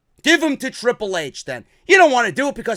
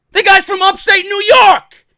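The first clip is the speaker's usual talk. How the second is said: high, loud burst of disbelief; exasperated shout; 8 semitones higher, 6 dB louder than usual. Real, not acted. Fan defending a wrestler's northern roots, exasperated shout. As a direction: shout the obvious fact in exasperation. Exasperated at southern accent demand.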